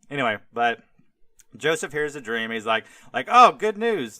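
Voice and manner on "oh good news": excited. Retold or imitated. Joseph cheerfully reads the dream.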